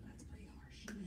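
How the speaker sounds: a hushed wry aside to the congregation; harsh verse met with a whisper. Real, not acted. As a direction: whisper it under your breath.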